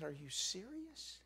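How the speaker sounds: high, hushed, drawn-out incredulous question; small stunned voice, acted out; retold incredulity. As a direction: squeak it softly in slow disbelief. Asks again, still disbelieving.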